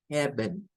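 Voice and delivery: "heaven" as eben, with a short e; English "heaven". Shown as a demonstration. Teacher modelling the right "heaven". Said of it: "No es ea".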